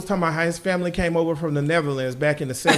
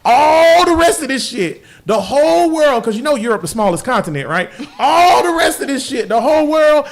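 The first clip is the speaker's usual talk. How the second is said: loud declaration; 5 semitones higher, 4 dB louder than usual. Real, not acted. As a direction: proclaim it to the whole world.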